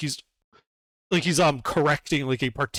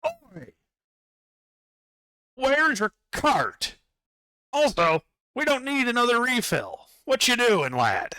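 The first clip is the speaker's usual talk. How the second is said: higher bossy overseer voice; Duergar foreman calling out; 10 semitones higher than usual. Acted. Duergar foreman calls out to Hebron, higher and bossy. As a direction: hail the new worker like a pushy dwarf boss. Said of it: "And he calls out".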